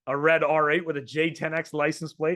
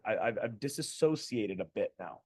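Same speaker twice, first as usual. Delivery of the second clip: ordinary delivery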